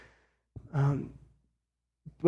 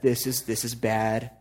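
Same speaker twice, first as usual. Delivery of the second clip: mock panic; 5 semitones lower, 5 dB quieter, slower than usual. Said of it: "disciples are freaking out".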